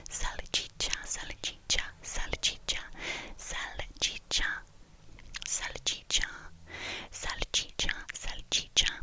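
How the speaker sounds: salchicha whispered with crisp ch sounds, sal-CHEE-chah; slow repeated salchicha ASMR whisper; whispered trigger word. Shown as a demonstration. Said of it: "like, when I whisper it".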